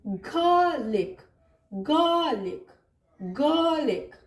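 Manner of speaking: "गार्लिक" with a long first vowel and no r; lengthened vowel, silent R. Shown as a demonstration. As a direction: slowly stretch the first vowel of "गार्लिक", drop the r. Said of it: "इसके sound को थोड़ा लंबा कर देंगे".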